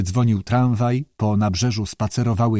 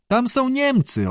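high, slow, drawn-out doubt; sceptical; 12 semitones higher than usual. A narrator's reading. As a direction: wave it off sceptically, pitched way up. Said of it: "oświadczył staruszek sceptycznie".

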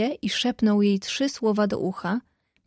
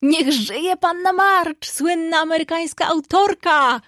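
much higher, louder and slow, a triumphant cheer; joyful exclamation; 7 semitones higher, 4 dB louder than usual. A narrator's reading. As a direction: hail her like a famous author, proudly. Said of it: "wykrzyknął Artur".

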